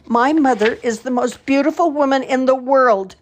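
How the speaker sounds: put-on high, loud tearful little girl; sobbing child. A narrator's reading. Lost girl sobs out her mother's praise, high and tearful. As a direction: blurt it out through sobs. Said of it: "she sobbed out".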